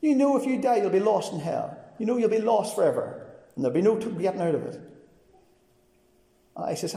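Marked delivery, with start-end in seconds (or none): 0.0-4.8 higher, louder retold shout; the young coworker shouting after him as he walks away; shouted back. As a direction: call after him in exasperation. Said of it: "he shouted back at me".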